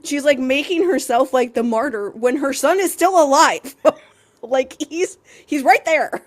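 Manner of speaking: exasperated. Real, not acted. Exasperated rant about Circe.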